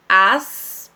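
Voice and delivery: loud "as" with a long held S; "as" cut off as its own syllable; first S isolated. Shown as a demonstration. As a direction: punch "as" and let the S hiss on. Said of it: "primeiro S".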